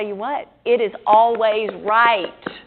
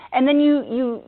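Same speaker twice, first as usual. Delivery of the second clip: usual speaking voice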